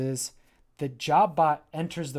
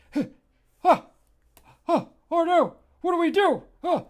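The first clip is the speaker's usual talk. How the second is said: much higher, loud breathless panic; panicked gasps; 10 dB louder than usual. Acted. Pillows scrambling from Jobbot, high gasping panic. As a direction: gasp and squeal in fright.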